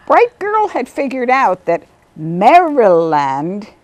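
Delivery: ordinary talk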